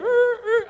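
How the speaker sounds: high, loud engine whine; imitated car noise. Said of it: "So I heard".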